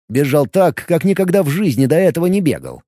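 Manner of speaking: plain reading voice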